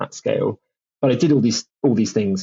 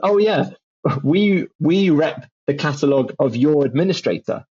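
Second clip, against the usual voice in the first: laughing publisher; 5 semitones higher than usual. Retold or imitated. Imitating the rep chuckling about the admin deal, high and giggly. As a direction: laugh through it, pitched up. Publisher staff voiced in anecdote.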